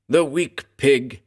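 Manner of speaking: snarled. A narrator's reading. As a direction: spit the insult slowly with scorn. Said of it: "he snarled".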